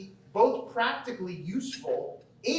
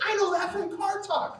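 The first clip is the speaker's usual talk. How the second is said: much higher, delighted retold exclamation; excited recognition; 12 semitones higher than usual. Fan recalling a spotted radio-show landmark, excited recognition. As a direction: blurt it out high, like a thrilled fan.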